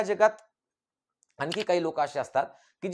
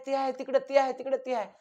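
high, frantic cries of warning; urgent high-pitched repeated pleading; women yelling at the screen; 10 semitones higher than usual. Acted. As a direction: mimic village women shouting at a film heroine. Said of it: "लोकच ओरडायला लागतात, त्या बायाच ओरडा".

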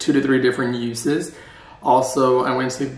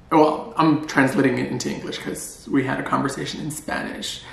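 normal talking voice

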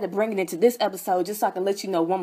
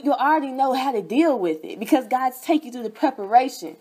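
ordinary talk, nothing marked

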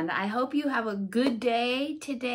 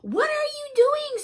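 high squeaky pig voice, much higher; Piggy's voice; 6 dB louder than usual. Acted. Piggy asking Elephant a high squeaky question. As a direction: pitch way up for the curious little pig.

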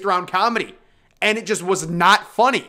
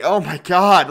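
loud, exasperated oh my God; mock loud groan at the screen; loud exasperated exclamation; 6 dB louder, slower than usual. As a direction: blurt it out in disbelief. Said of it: "you wanna say".

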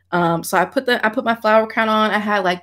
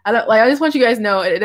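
ordinary talk, nothing marked

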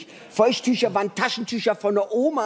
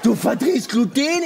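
amazed barista; 5 semitones higher, 4 dB louder, slower than usual. Retold or imitated. Barista astonished at customer.

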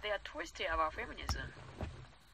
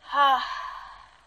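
heavy sigh; 7 semitones higher, 11 dB louder than usual. Real, not acted. Reacting to the news clip.

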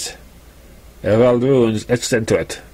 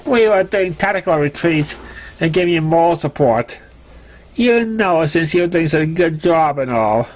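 higher, sheepish filly voice; Scooter making excuses; 4 semitones higher than usual. Acted. Scooter excusing her retreat to Sparkler, higher sheepish voice. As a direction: wriggle out of it with a flattering grin.

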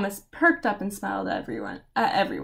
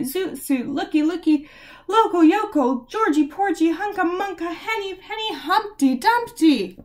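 frantic excited babble; 4 semitones higher, 3 dB louder than usual. Acted. Frantic flood of words.

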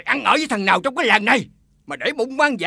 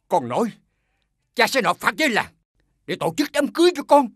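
usual speaking voice

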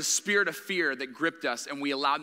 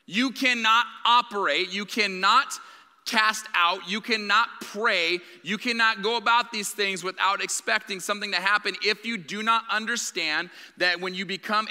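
forceful preaching; 3 semitones higher than usual. Acted. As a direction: drive each cannot home from the pulpit. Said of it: "preaching at full force".

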